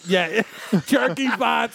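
slow, laughing shout of turkey farts. Real, not acted. Friend laughs at the turkey fart joke, shouting slowly. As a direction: crack up and shout it out.

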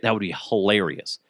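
usual speaking voice

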